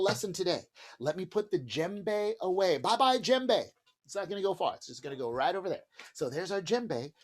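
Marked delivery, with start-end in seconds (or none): none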